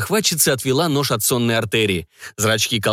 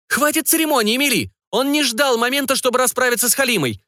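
much higher, hammed-up furious outburst; 13 semitones higher than usual. A narrator's reading. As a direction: explode at Emily to hurry her. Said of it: "взорвалась Бернадет".

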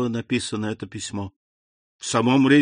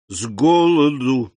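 slow, heavy stress on ГОЛОДУ; emphatic repetition; 2 semitones higher, 4 dB louder, slower than usual. A narrator's reading. Caderousse repeats his answer to the abbé, stressing ГОЛОДУ hard.

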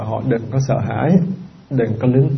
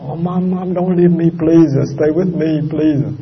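higher, pleading cries of mom, don't leave; mock desperate plea; 4 semitones higher, slower than usual. Monk showing the wrong way a child begs a dying mother, mock desperate plea. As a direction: ham up a clinging child begging mom to stay. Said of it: "chứ đừng nói".

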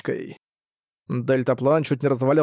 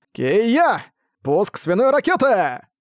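battle cry; 6 semitones higher, much slower than usual. Acted. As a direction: kick him off with a war cry. Арк пинает Буксила. (боевой выкрик)

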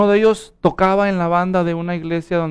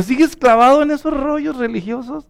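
higher-pitched retold jeer, incredulous; mocking; 8 semitones higher than usual. Lapsed friend ridicules religious commitment, mocking. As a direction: imitate a scoffer needling a believer. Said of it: "se burló de mí".

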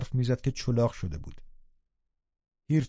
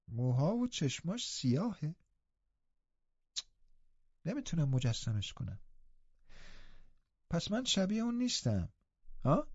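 put-on higher, soft and hesitant boy voice; frail boy Linton, uneasy; 5 semitones higher, 8 dB quieter than usual. A narrator's reading. Linton asks Nelly whether he looks like his father, unsure.

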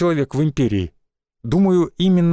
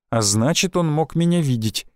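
plain reading voice

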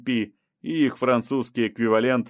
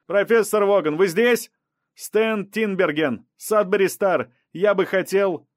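high, louder reporter's call; raised high-pitched shout; 9 semitones higher, 4 dB louder than usual. A narrator's reading. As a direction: hail someone inside the house with eager insistence. Reporter calling out to people indoors.

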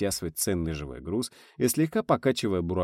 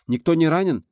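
higher, louder anxious question; worried radio call; 9 semitones higher, 7 dB louder than usual. A narrator's reading. (тревожный оклик)

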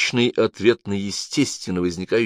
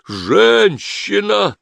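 very high, loud, drawn-out cry; frantic stretched exclamation; wild cry; 13 semitones higher, 6 dB louder, much slower than usual. A narrator's reading. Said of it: "дико вскричал Жан-Пьер".

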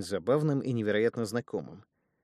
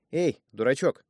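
louder teasing call; mocking call; 5 semitones higher, 8 dB louder than usual. Brother interrupts the prayer with a loud jeer. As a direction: cut him off with a loud jab.

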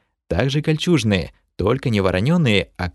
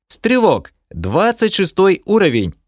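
eager archer; 10 semitones higher, 4 dB louder than usual. Acted.

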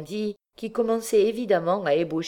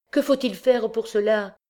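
eager hopeful question; 8 semitones higher, 4 dB louder than usual. Acted. Eager mother asking.

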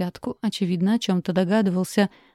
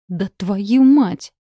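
slow, loud angry hiss; hissing curse; 7 dB louder, much slower than usual. A narrator's reading. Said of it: "прошипел он".

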